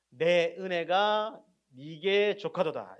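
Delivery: loud, very slow proclamation in God's role; God's solemn declaration. Acted. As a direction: proclaim it slowly and loudly, drawing out the words. Preacher voices God's answer to Paul. (하나님의 말씀을 크고 느리게 선포하듯)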